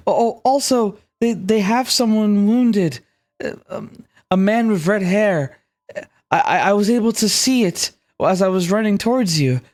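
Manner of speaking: put-on high, anxious girl voice; young girl voice. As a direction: warn them about the wounded man. Saley reports urgently.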